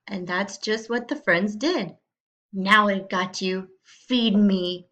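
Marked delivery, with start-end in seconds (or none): 2.5-4.9 menacing shout. Acted. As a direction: gloat like a hungry fox trapping prey. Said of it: "shouted Foxy Loxy".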